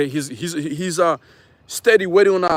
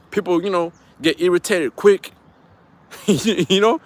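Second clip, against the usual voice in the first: usual speaking voice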